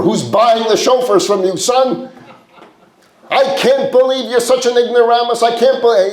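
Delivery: high, exasperated scolding; father berating his son over the shofars; insulting father. Acted. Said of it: "he starts insulting his son again".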